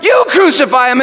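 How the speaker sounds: loud, high defiant yell. Retold or imitated. Pilate to the crowd, a loud defiant yell. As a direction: throw it back at the mob. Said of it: "And I yelled".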